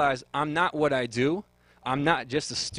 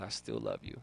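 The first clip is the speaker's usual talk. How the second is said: much lower and softer, a deep fatherly voice; God's voice; 13 semitones lower, 9 dB quieter than usual. Retold or imitated. God telling a hidden sinner he still loves him, deep and low. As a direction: drop way down low and speak it gently. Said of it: "and he says".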